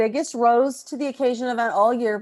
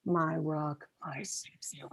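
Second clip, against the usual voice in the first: low, soft sultry purr; flirty coo; 8 semitones lower, 12 dB quieter than usual. Acted. Wife greeting husband lovingly, low and soft.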